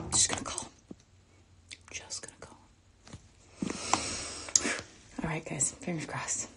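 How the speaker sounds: hushed self-talk, barely voiced; tense whisper under the breath; nervous whispering. Real, not acted. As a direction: whisper it to yourself to steady the nerves. Nervous self-talk before calling.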